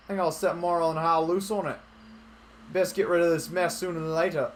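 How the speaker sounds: put-on high, loud old tree keeper reading dialogue; hammed-up country elder voice; folksy villager voice. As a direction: play the gruff old villager.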